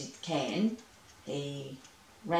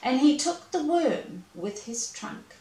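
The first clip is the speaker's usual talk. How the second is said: usual speaking voice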